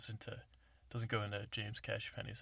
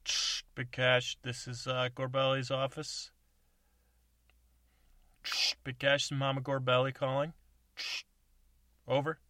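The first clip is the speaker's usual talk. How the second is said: over CB radio; 4 semitones higher, 8 dB louder than usual. Acted. CB radio call.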